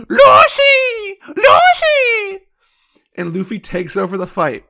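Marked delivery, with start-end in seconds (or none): 0.1-2.5 very high, loud drawn-out wail of a name; hammed-up tearful scream, mocking a crybaby. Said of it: "Rebecca screams again".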